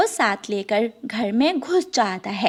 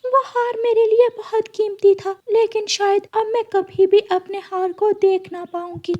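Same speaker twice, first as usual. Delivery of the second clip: higher, grieving queen's lament; high sad queen voice; 10 semitones higher than usual. Acted. Queen grieving her lost necklace, voiced higher.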